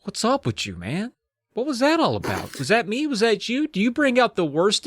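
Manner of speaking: high, taunting doll voice; a sinister doll toying with the girl; creepy doll voice. Acted. Creepy doll taunting the girl, high and teasing.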